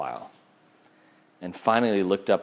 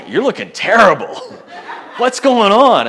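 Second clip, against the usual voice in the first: much higher and louder, a laughing bro jab; loud laughing guy greeting; 12 semitones higher, 12 dB louder than usual. Shown as a demonstration. Preacher acting how guys greet at the gym, loud laughing jab. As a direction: bellow it across the gym with a laugh. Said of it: "Which guys can say that to each other".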